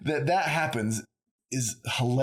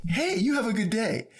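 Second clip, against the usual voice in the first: much higher, bouncy imitation of a passing guy; mock friendly stranger; 11 semitones higher than usual. Mimicking the guy with earphones greeting her, much higher. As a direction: lightly impersonate a friendly stranger.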